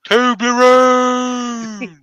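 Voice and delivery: high, loud, long-held call. Pretend. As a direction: stretch it out like a whale call. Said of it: "their own calling sign".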